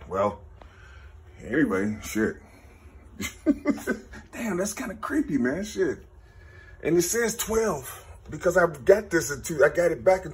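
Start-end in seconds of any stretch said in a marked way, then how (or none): 3.2-4.3 breathy laugh. Real, not acted. Disbelieving laugh.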